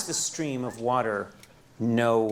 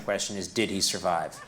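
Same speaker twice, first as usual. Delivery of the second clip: ordinary delivery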